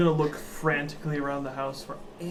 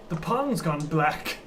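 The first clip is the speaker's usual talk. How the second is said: slow ominous line; slower than usual. Acted. Player in character, first take.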